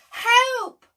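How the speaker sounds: high, loud cry for help; shouted help. Acted. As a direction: yell it like a sailor in a storm. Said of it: "shouted Jim".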